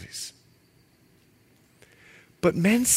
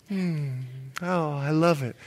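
softer, savoring sigh of pleasure; mock delight; 2 semitones lower, 7 dB quieter than usual. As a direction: relish the scent, softly. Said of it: "it's like".

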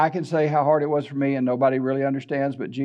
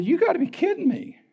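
disbelief; 12 semitones higher than usual. Retold or imitated. Patient answering the doctor's diagnosis in high stunned disbelief. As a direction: gasp it out way up in disbelief.

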